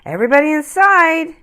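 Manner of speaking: high, loud cheerful call; merry mother. Acted. Mother calling children inside, high and merry. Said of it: "she said merrily".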